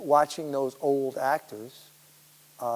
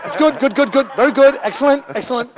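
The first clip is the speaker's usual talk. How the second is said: higher, louder rush of praise to himself; imitating an excited Scorsese; 10 semitones higher, 13 dB louder than usual. Imitating Scorsese psyching himself up after a take, excited. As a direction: gush the praise like a keyed-up director. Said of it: "You could see he was talking to himself".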